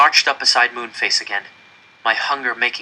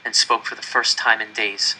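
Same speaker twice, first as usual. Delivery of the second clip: ordinary delivery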